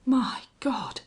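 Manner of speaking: shocked whisper; voiceless, awed dread. A narrator's reading. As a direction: whisper it as the truth sinks in. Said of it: "whispered the other".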